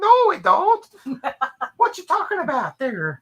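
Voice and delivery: very high mock Minnesotan denial; squeaky-high imitation of Minnesotans; imitated Minnesotan protest. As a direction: protest in a put-on Minnesotan accent. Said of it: "And they're like".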